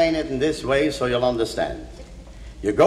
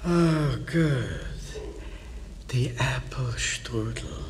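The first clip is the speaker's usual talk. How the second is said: weak dying whisper; 4 semitones lower, 11 dB quieter, slower than usual. Acted. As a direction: breathe it out faintly.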